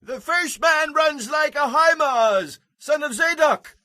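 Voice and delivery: very high, slow call; calling watchman. Acted. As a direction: call the runner's name from afar.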